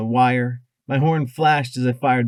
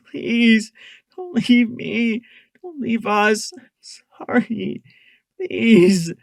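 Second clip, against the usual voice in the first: high, tearful pleading cries; desperate begging; 12 semitones higher, 5 dB louder, slower than usual. A narrator's reading. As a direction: beg him not to die. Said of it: "I begged his slack face".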